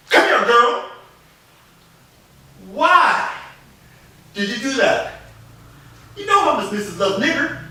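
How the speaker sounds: a hint of a strict master; stern father calling. Master calls and scolds girl.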